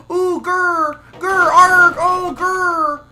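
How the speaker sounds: hammed-up ghost growling; mock growls. Gamer growling to mock the ghost.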